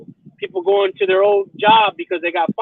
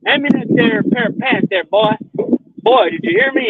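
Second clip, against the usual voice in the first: put-on higher bossy old-timer barking orders at the boy; 7 semitones higher than usual. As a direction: order the boy around like a self-styled master, pitched up. Imitating a bossy prank character.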